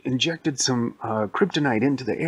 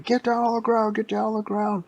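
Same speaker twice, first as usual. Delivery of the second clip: high, urgent mock police shout; acted police yelling; 11 semitones higher than usual. Police storming the convenience store, high urgent yelling. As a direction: order them down in a high-pitched yell.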